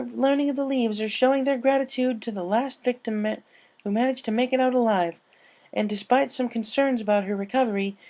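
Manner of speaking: cheerful commentator; co-host announcer. Acted. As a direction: hype up the crowd on air. Twilight commentary.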